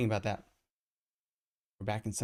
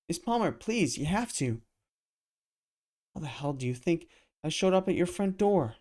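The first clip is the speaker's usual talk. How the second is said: high, loud desperate begging; desperate pleading; 8 semitones higher, 6 dB louder than usual. Acted. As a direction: plead to be heard. Kaiden begs Palmer frantically.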